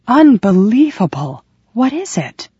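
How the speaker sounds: amazed, pitch rising to a high question. Acted. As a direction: marvel at the taste. Enraptured by the dessert.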